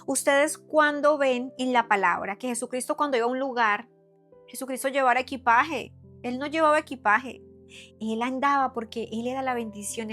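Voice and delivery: ordinary talk, nothing marked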